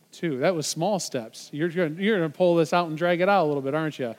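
usual speaking voice

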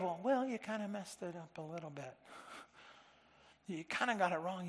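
soft, gentle understated chiding; mild soft correction. Shown as a demonstration. Preacher contrasts Paul with a soft mild rebuke. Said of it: "it's not the idea of".

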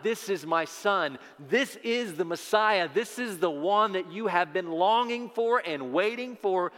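ringing preacher's shout, building to the end; calling out. Acted. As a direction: call it out as God's announcement to everyone. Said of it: "And it's calling out".

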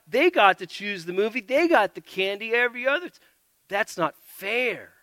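put-on slow, high, loud whiny kid protesting; whiny child. A child complains to a parent about a sibling, whining. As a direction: whine it like a kid cheated out of candy. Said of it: "Or how about".

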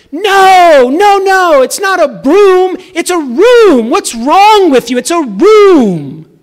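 very high, loud hammed-up scolding; mock-berate the child over her word; dad mock-scolding daughter's mispronunciation, hammed-up stern. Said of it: "a stern face".

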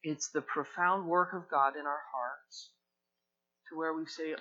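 normal talking voice

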